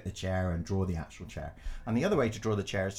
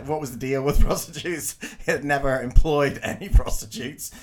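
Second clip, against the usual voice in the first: louder, words breaking into laughter; laughing; 5 semitones higher, 9 dB louder than usual. Real, not acted. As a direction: laugh through it, amused. Laughing while telling taxi story.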